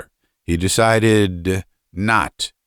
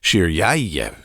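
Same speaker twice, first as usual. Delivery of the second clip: plain reading voice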